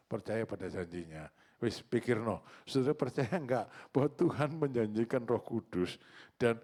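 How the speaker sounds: usual speaking voice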